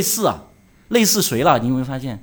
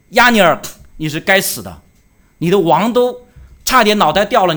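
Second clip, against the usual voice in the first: louder, carrying call across a distance; 3 semitones higher, 7 dB louder than usual. Retold or imitated. David calling from afar to Abner, a louder mock shout. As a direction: shout it over to the sleeping guard. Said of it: "又喊".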